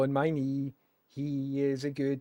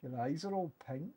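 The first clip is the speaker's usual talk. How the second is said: ordinary talk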